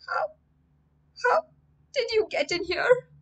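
put-on high, frightened boy's voice; a scared boy choking out a question; choked out. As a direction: force the words out through fear. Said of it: "I finally managed to choke out".

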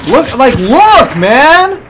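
slow, high, loud plea; exasperated yell. Real, not acted. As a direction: yell it, fully fed up. Raging at lag.

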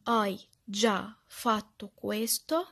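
very slow, each word set apart; word-by-word repeating. Shown as a demonstration. Teacher drilling the phrase for learners, slow word-by-word repetition. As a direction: drill it slowly, pausing between words. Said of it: "اجي انا بقى اردد".

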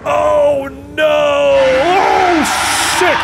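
sudden high scared yell; frightened shout. Real, not acted. Player spots the old man and yells in fright. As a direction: cry out in real fright at the monster.

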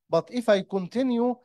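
usual speaking voice

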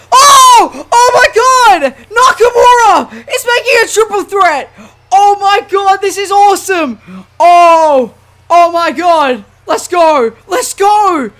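high, loud, elated hollering; thrilled shouting. Real, not acted. Gamer seeing Nakamura make a triple threat, thrilled shouting. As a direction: erupt with real excitement.

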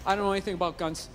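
low, subdued confession; honest admission. Retold or imitated. Comic admits ignorance to gun seller, low honest confession. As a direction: own up to it, pitched low. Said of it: "I'm gonna go with honesty".

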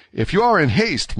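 high, guileless politeness; simple courtesy. A narrator's reading. D'Artagnan addressing his duel opponent, simply courteous. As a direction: offer it plainly and politely, pitched high. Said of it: "with the same simplicity".

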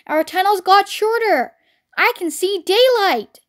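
put-on high young fox's voice; alarmed small fox. A narrator's reading. Small fox alerts family to danger.

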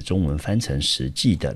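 usual speaking voice

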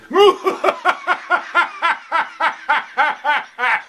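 high, cackling mad laugh; evil mad laugh. Pretend. After It's alive.

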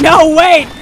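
loud, high "no, wait"; desperate shout. Retold or imitated. Watching her horse run into gunfire, desperate shout. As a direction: yell as if it's too late.

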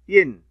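ين said as a short loud "in" ending; tanween sound. Shown as a demonstration. Teacher demonstrating kasra tanween, loud and clipped. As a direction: sound out ين as the nunation "-in". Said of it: "وبالكسر".

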